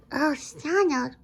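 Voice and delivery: ordinary talk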